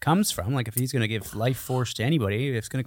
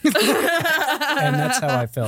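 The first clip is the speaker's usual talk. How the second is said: slow, high, through laughter; laughing; 11 semitones higher, much slower than usual. Real, not acted. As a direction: laugh the words out slowly. Laughing while retelling reaction.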